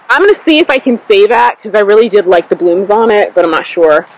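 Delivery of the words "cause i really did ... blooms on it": ordinary talk, nothing marked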